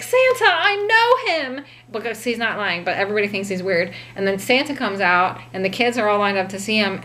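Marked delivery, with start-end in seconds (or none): 0.0-1.6 high, slow and louder, bursting; giddy childlike shout; thrilled. Retold or imitated. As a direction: announce it like the best news ever. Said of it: "He gets so fucking pumped".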